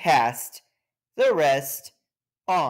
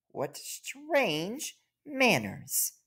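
slow, soft, a bit higher; ant's private thought; 8 semitones higher, 5 dB quieter, much slower than usual. Acted. The ant thinks to herself about rude guests, slow and soft. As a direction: murmur it slowly in wonder.

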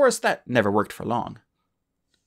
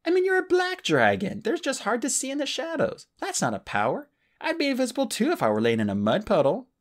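much higher, teasing argument; Clay arguing; 14 semitones higher than usual. Acted. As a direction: needle him, amused. Clay arguing, voiced high.

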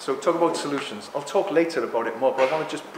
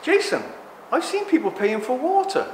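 visiting alien; 7 semitones higher than usual. Retold or imitated. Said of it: "Imagine someone coming from another planet".

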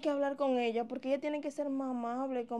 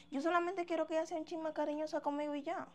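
ordinary delivery

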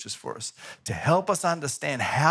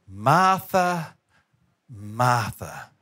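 first Martha louder, second Martha dropping low; a loving rebuke, Martha then Martha falling; calling name twice; 6 semitones lower, 3 dB louder, much slower than usual. Retold or imitated. Jesus gently calling Martha twice, falling warning. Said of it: "this is what he says to her".

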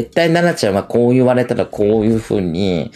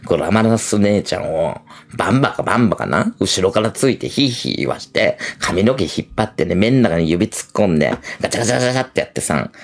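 lower, crude macho brag; lowered sleazy guy voice; Masatsugu voice; 4 semitones lower than usual. Retold or imitated. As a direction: play a lewd boastful man low. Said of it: "正嗣がな".

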